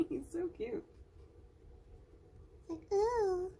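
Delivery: high delighted coo; gushing. Real, not acted. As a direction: coo it over something adorable. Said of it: "gushing over the drawing".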